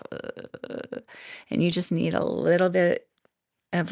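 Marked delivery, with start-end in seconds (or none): 0.1-1.0 breathless, soft "Ugh" sigh of low energy; weary ugh. Retold or imitated. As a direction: let out a tired, toneless "Ugh". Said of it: "if you're feeling like".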